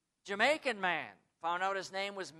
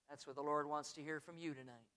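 ordinary talk

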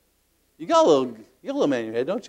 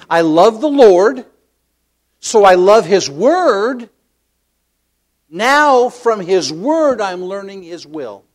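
loud, slow, high declamation; emphatic preaching; 5 semitones higher, 9 dB louder, much slower than usual. Real, not acted. Preacher linking love of Lord and word, emphatic preaching. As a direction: proclaim each clause, stretching the key words.

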